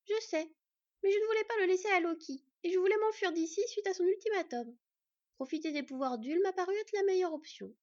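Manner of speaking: put-on a desperate young girl explaining herself; young fox girl. Sylveig's lines voiced higher.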